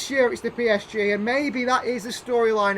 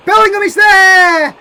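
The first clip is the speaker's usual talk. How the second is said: big, high, slow shout; excited shout; 10 semitones higher, 16 dB louder than usual. Real, not acted. Gamer bellowing as his striker breaks through, full shout. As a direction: yell it as the chance opens up.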